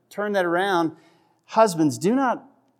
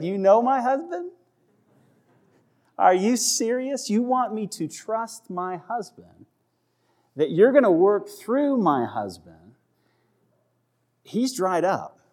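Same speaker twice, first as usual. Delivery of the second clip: high, disbelieving mock protest; incredulous Sarah; 5 semitones higher than usual. Sarah scoffing at God's promise, high incredulous mock voice. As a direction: play Sarah scoffing that her husband could be the one. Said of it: "She's like".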